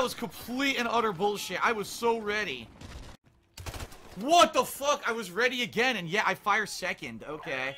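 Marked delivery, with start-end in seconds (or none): 4.2-5.0 loud, high cry of disbelief; real exasperated anger; outraged outburst. Real, not acted. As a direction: yell it at the game. Player outraged at game death.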